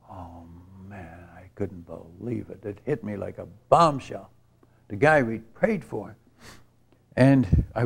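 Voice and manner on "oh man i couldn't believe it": stunned hush. Real, not acted. Learning a dear friend had died.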